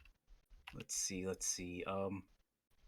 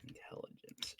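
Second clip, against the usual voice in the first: whisper; 15 dB quieter than usual. Real, not acted. Player checking character sheet.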